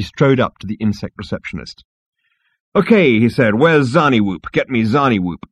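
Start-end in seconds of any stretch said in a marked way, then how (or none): 2.8-5.4 loud, cocky demand; brash demand. Acted. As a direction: bark it at the desk.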